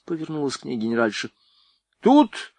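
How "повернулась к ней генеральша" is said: usual speaking voice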